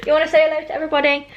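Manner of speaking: high, fast doggy voice; talking to the dog. Real, not acted. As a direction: coo at the dog.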